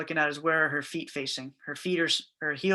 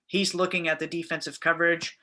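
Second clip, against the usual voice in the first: ordinary delivery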